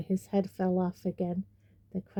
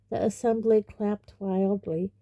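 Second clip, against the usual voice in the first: ordinary delivery, nothing marked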